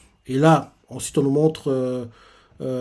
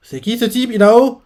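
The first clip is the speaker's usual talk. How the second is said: sharp higher question, louder on the name; startled demand, pitched up; 6 semitones higher, 4 dB louder than usual. Retold or imitated. Soya asks Inao who the stranger is, startled and higher.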